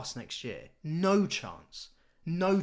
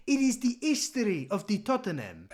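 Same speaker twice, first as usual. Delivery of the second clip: mock Italian accent; 5 semitones higher than usual. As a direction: ham up a Italian coach's lament. Mocking Spurs managers' excuses.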